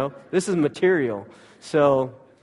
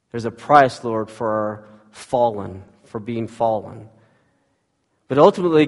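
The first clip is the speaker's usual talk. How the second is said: fervent spoken prayer, louder on the openings; pastoral prayer; 2 semitones lower, 5 dB louder than usual. Acted. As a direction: lead the church in earnest prayer. Pastor prays aloud.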